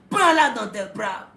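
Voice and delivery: ordinary talk, nothing marked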